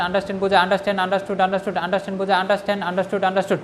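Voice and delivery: quick, even memorising chant, no pauses between words; rapid rote recitation. Shown as a demonstration. Teacher shows students how to memorise verbs, rapid rote chanting. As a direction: run the word lists together in a fast monotone. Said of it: "এই পঞ্চাশটা vocabulary কীভাবে পড়তে হবে? যেরকম".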